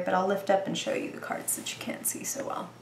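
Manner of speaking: voiceless half-whisper under the breath; whispered aside. Real, not acted. Reader whispering while lifting cards to the camera. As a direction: murmur it to yourself while handling the cards.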